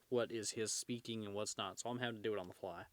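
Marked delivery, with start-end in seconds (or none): none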